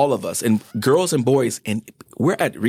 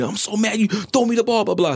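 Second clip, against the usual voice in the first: put-on upset crying kid; 11 semitones higher than usual. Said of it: "He's just crying".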